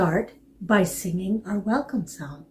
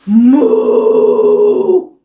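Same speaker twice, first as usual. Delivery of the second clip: loud drawn-out moo; cow moo; 4 semitones higher, 12 dB louder than usual. Acted. Dog moos again.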